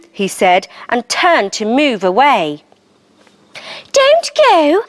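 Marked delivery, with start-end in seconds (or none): 3.9-4.8 put-on high, bright little frog girl. A narrator's reading. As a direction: voice a friendly tiny frog. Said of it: "said the little frog, smiling".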